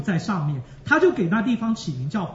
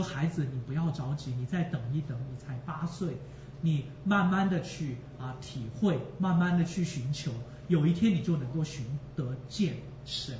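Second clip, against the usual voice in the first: softer and lower, reassuring; gentle comfort; 5 semitones lower, 5 dB quieter than usual. Retold or imitated. Father reassures his eight-year-old daughter, softer and lower. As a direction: soothe a worried child.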